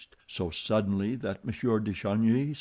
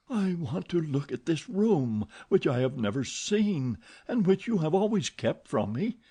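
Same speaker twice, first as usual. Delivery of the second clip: higher, trying to sound light; forced playful; 8 semitones higher than usual. Acted. Christine forcing playfulness to fool Eric. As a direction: fake a playful tone to hide fear. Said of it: "tone she tried to render playful".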